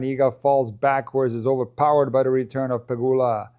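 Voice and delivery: normal talking voice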